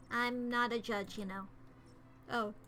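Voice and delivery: ordinary talk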